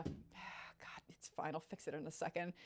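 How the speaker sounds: unvoiced sigh-whisper; tired whisper; exasperated whisper. Real, not acted. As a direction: breathe it out, resigned. Sighing after light outage.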